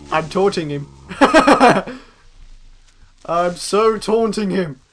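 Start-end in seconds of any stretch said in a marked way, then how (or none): 1.1-2.0 loud, high burst of laughter. Real, not acted. Player delighted at taunting a mob, loud laugh.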